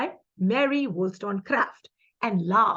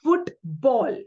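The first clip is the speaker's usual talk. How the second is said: "Football" as two equal beats, FOOT-BALL; both syllables stressed. Shown as a demonstration. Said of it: "Both syllables are stressed".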